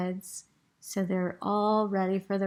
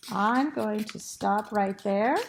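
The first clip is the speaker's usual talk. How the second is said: usual speaking voice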